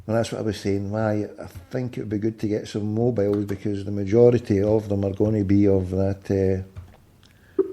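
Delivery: low, soft thinking aloud; talking to himself. Real, not acted. Canvasser weighing up whom to phone next, quiet musing.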